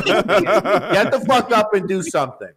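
slow, laughing command; slow laughing order. Acted. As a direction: drag out each word with a laugh. Repeats the order, slower, laughing.

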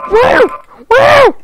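very high, loud whoops; excited whoop. Real, not acted. Gamer celebrating a jump, excited whooping. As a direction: let out a short shrieked woo.

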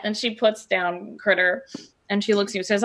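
usual speaking voice

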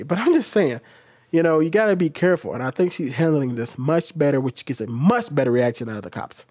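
ordinary talk

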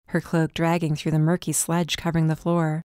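ordinary delivery

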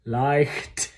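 Leicht with a hissing h like a cat and a crisp final t; hissed h. Shown as a demonstration. Choir coach showing the hissed h in Leicht. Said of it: "remember that's like cat hissing".

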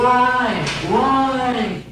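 high, drawn-out mock wail; imitated screaming. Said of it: "she's just straight screaming".

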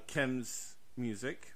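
normal talking voice

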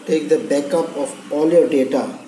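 ordinary delivery

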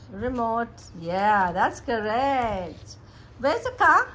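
drawn-out, high-pitched encouragement. Real, not acted. Mother quizzing her baby on pictures, playful baby talk.

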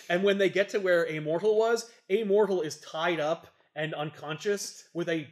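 ordinary delivery

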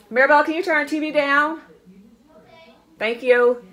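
high, loud call across the house. Real, not acted. Vlogger calling to Maribel in another room, loud and high. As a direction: call out to Maribel so she hears over the TV.

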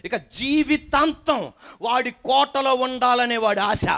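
high, driving preacher's rebuke; fiery preaching. Acted. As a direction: preach it hard to the congregation. Preaching at full force. (ఉద్రేకంతో కూడిన బోధ)

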